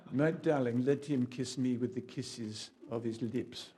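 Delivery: slow, put-on French accent. Shown as a demonstration. Preacher performing Song of Songs for the congregation, French accent. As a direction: play a French lover reciting poetry. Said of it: "do it with a French accent".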